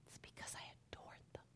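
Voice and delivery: soft confiding whisper. Real, not acted. Speaker confides to the women why she kept the boys close, whispering. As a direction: let them in on a secret, breathless and hushed.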